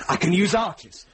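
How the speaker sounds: light up as the plan clicks into place; eager realization. Acted. Sheriff to Cadfael planning the attack, suddenly eager. Commander seizing on a battle plan.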